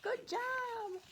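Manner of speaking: high, loud cheers; cheering crowd. Acted. The crowd cheers Otis for saving the calf, loud and high. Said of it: "the crowd here is clapping".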